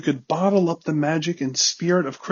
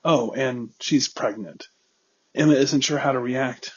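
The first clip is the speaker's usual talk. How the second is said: ordinary delivery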